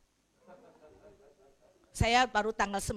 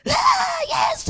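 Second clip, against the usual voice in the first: high, loud cry to Jesus; retold shower shout; 10 dB louder, much slower than usual. Widow retelling her shower scream to Jesus, loud high cry. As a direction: yell it out in the shower. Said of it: "jadi lagi mandi gua teriak".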